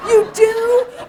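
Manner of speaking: high, loud, delighted disbelief; amazed squeal. Retold or imitated. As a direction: squeal it out, can't believe it. Her own amazed reply.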